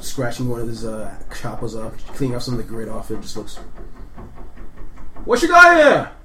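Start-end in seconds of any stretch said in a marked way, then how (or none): 5.2-6.1 gruff orc bellow, much higher and louder; orc guard. Acted. GM voicing orc guard demanding what the player holds. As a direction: orc guard barking a challenge.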